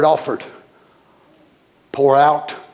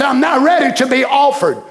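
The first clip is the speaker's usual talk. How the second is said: joyful; 7 semitones higher than usual. Acted. Preacher quoting Paul with joy.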